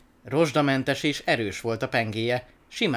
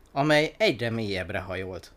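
ordinary delivery, nothing marked

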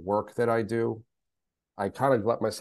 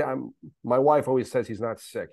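ordinary talk, nothing marked

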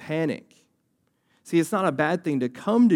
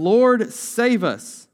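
raised, urgent cry for help, read aloud; shouting; 7 semitones higher, 6 dB louder, much slower than usual. A narrator's reading. Pastor reads the disciples waking Jesus in the storm, shouting. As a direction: call out higher and louder, stretching the last words.

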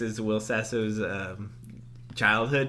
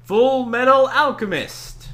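dramatic announcer; 12 semitones higher, 10 dB louder, slower than usual. Acted. Dramatic anime title announce.

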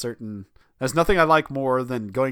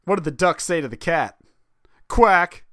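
mock kid joke; 6 semitones higher, 9 dB louder than usual. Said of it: "one of those terrible ones".

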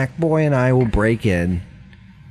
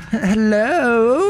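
high squeaky hello; 9 semitones higher than usual. Acted. Greeting the creatures.

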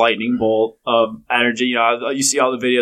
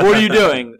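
higher, louder imitation of startled disbelief; mock exclamation; 6 semitones higher, 5 dB louder than usual. Retelling a golfer catching his wife, mock exclaiming. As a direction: call it out as a retold protest.

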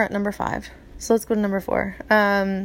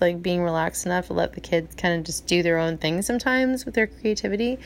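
usual speaking voice

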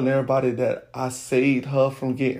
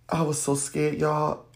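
ordinary talk

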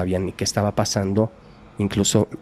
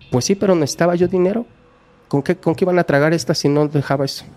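madre cínica; 8 semitones higher, 3 dB louder, faster than usual. Retold or imitated. Mother justifying herself to police.

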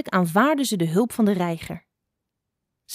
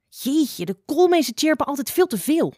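higher, mocking sneer; a higher, scornful sneer; 7 semitones higher than usual. A narrator's reading. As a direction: scoff at the chattering birds. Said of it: "sneerde Spotlijster".

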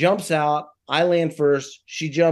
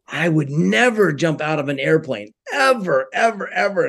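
retold client's firm never, higher voice; adamant, higher-pitched quoted refusal; emphatic refusal; 4 semitones higher than usual. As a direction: stretch NEVER, then punch each high "ever".

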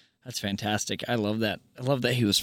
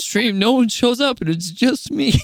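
hammed-up whiny pity voice, higher and louder; mock sad; 9 semitones higher, 5 dB louder than usual. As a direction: fake sob story for laughs. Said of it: "Let me get the sad music going".

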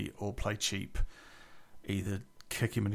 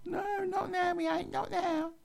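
very high squeaky imitation of her; mock whiny refusal. Roommate refusing a talk through the door, squeaky mock whine. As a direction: mimic her in an exaggerated falsetto protest.

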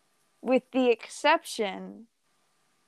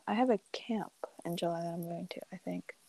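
quick muttered whisper, much softer; 10 dB quieter, faster than usual. Real, not acted. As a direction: tuck it away under your breath. Half-hiding a summer plan.

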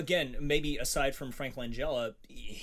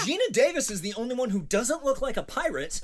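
high-pitched, louder complaint; worked-up rant; 9 semitones higher, 5 dB louder than usual. Real, not acted. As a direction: insist on it in exasperation. Keeps ranting about the cast.

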